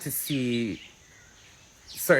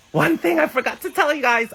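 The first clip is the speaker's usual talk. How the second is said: sudden high excited burst; high-pitched excited reminder; excited blurt; 5 dB louder than usual. Real, not acted. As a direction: blurt it out, thrilled.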